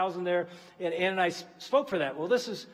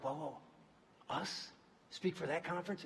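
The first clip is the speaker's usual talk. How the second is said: hushed disbelief; 2 semitones lower, 12 dB quieter than usual. Retold or imitated. Awed at being invited to speak.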